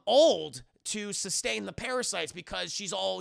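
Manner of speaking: usual speaking voice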